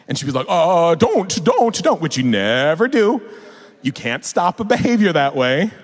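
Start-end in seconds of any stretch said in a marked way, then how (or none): 0.5-2.0 mock panicked plea, voice pitched up; panicking. Girlfriend reacting to the kid's swearing, in a panic. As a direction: imitate a flustered parent begging. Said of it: "Sarah would always panic".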